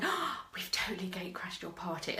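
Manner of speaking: half-whispered, retold gasp of guilt; hushed confession. Party crasher confessing to Pat, hushed and breathless. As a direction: whisper it like an owning-up.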